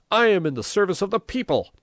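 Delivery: high, loud, indignant self-defence; indignant shout. A narrator's reading. Mayor defending himself to the priest, indignant shout. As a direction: proclaim it loudly, pitched right up.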